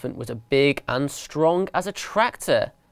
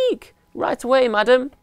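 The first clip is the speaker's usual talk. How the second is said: frightened squeak; 10 semitones higher than usual. Acted. Mice cowed obeying.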